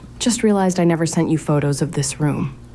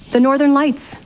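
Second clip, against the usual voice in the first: raised pitch, thrilled and awed; excited wonder; 8 semitones higher than usual. Acted. Stargazer marvelling at the northern lights, excited. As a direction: marvel at it, voice lifted high.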